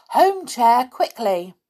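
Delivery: urgent cry, loud at the start; loud command. A narrator's reading. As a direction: call out an order to get away. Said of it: "cried out loudly".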